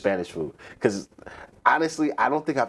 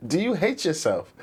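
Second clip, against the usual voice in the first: lightly raised, incredulous put-on voice; mock disbelief; 8 semitones higher than usual. To British cooks, baffled by curry pies in a raised voice. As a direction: pitch it up as if baffled. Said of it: "sometimes I be like".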